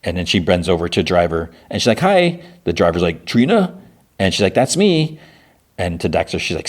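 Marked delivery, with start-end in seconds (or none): 2.0-2.4 high, chirpy retold hello; friendly greeting. Woman bending to a rideshare driver, high friendly greeting. 3.2-3.8 high-pitched, rising query; questioning name check. Retold or imitated. Driver checking his passenger's name, high questioning tone. 4.5-5.2 high, upbeat retold reply; friendly confirmation. Woman answering the rideshare driver, high friendly confirmation.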